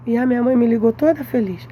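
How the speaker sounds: ordinary delivery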